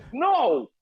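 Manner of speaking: loud, high, laughing through the words; laughing shout. Retold or imitated. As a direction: burst out laughing mid-quote. Laughing while voicing friend.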